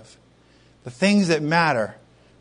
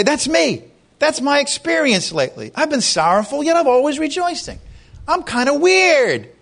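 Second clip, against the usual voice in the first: bemused confession; 8 semitones higher than usual. Pretend. Imagined believer confessing sorrowful joy.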